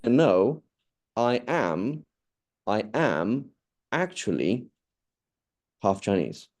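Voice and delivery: "am" and "actually" each set apart by pauses; emphatic insistence. Shown as a demonstration. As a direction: insist step by step, leaning on "am" and "actually". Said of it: "I now need to doubly emphasize".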